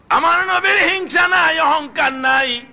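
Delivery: high-pitched sermon cry; ringing preacher's chant; impassioned preaching. Real, not acted. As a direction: proclaim it high, drawing out the line ends. Preacher resumes at full force.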